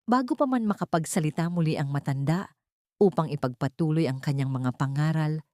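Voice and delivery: ordinary delivery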